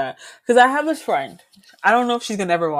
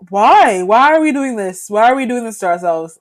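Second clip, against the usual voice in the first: exasperated plea; 2 semitones higher, 8 dB louder than usual. Retold or imitated. Acting out exasperation at forced slang.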